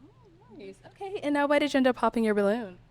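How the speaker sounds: ordinary talk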